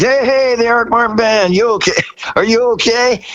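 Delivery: high, bright TV host voice; hammed-up announcer cheer; imitating Dick Clark.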